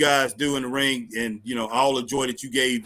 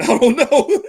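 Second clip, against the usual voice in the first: high, louder laugh through the words; 11 semitones higher, 9 dB louder, slower than usual. Real, not acted. To his cohost, about the album, laughing. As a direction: laugh while shrugging it off.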